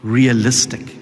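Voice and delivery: realistic set apart, low and firm; emphasized word. Real, not acted. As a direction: land realistic on its own with weight. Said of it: "I want to emphasize the word".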